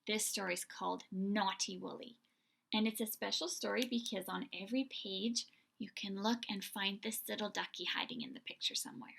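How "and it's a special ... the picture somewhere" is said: ordinary delivery, nothing marked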